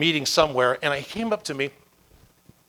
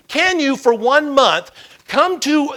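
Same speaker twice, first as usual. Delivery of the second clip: loud, raised, spaced-out challenge; emphatic challenge; 9 semitones higher, 9 dB louder, slower than usual. Retold or imitated. Mentor challenges a complaining firefighter, emphatic. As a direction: lay down the dare word by word.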